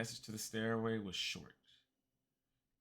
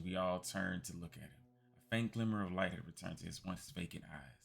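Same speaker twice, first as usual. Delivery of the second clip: ordinary talk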